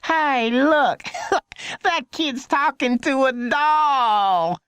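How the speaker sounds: put-on high child voice, calling out and laughing; a small boy jeering from a car window; high mocking kid's shout; little boy crying out. A narrator's reading.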